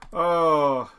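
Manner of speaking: usual speaking voice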